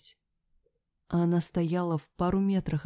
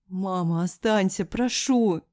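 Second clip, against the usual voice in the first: pleading cry, rising higher and louder; building desperate plea; wounded roar; 3 semitones higher, 5 dB louder, slower than usual. A narrator's reading. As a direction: beg loudly like a wounded animal. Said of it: "взревела, будто раненый зверь".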